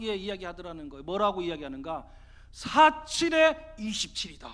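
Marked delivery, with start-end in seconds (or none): 2.5-4.5 high, booming, drawn-out proclamation; loud declaration. Acted. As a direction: declare it slowly at full volume. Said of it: "큰 소리로 막". (큰 소리로 우기듯 외치기)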